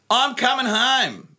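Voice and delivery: bad New Zealand accent impression. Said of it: "my New Zealand accent is terrible".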